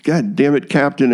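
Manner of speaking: reliving the anger at the captain, mildly; retold angry outburst. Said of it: "I got really angry".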